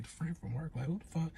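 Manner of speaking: quiet, disbelieving under the breath; incredulous hushed aside. Retold or imitated. To herself, hearing his mama cover story, hushed disbelief. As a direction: mutter it in shock, barely audible. Girlfriend hears "friend from work" excuse.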